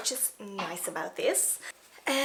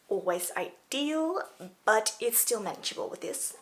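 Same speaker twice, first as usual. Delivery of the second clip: ordinary talk, nothing marked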